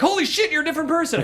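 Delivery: high, shocked exclamation; mock astonishment. Viewer fooled by glasses, high mock astonishment. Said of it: "being like".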